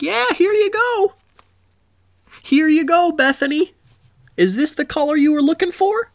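put-on very high sweet old lady voice. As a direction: squeak it like a sweet granny. Voicing the old shop lady.

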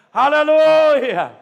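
long loud drawn-out hallelujah; sustained shouted hallelujah; called hallelujah. Acted. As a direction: call it out to the hall. Preacher calls hallelujah.

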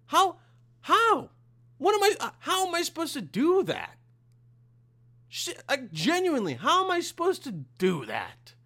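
loud, high-pitched disbelief; incredulous outburst. Real, not acted. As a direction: demand answers in exasperated, high-pitched disbelief. Ranting about three exams in one day.

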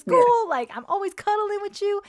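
high, loud retold outburst; angry hurt protest. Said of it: "I felt myself getting angry".

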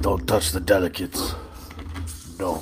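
put-on Russian accent. Russian Kool-Aid guards his delicates, low Russian accent. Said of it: "I think you insist on risque".

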